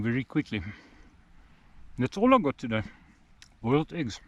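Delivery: talking while chewing; words round a bite of egg; mouth full. Real, not acted. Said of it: "Sorry for talking with food in my mouth".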